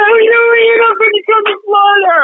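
high, laughing repeat of a joke; laughing echo. Real, not acted. Guest echoing host's joke about coming to Florida, laughing.

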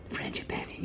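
whispered. Real, not acted. Savoring the word.

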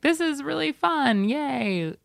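politely pleased yay; polite mild enthusiasm. Retold or imitated. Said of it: "be like".

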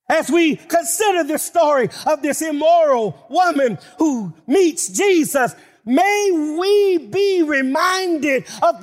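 preaching at full force. Acted. Preacher exhorting brothers and sisters, impassioned full-voice climax. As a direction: lift the congregation with rising fervor.

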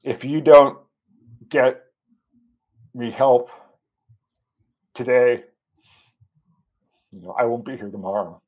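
halting, real emotion with long pauses between words; deeply moved. Real, not acted. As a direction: struggle to get the words out, pausing heavily. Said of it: "see how deeply it still affects me".

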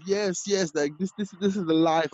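high, giddy and climbing to a squeal; hyped. Retold or imitated. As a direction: celebrate like you just got famous. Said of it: "And I was so hyped".

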